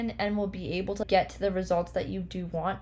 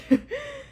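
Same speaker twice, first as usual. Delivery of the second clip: giggle; 4 dB louder than usual. Real, not acted. Giggles about own voice.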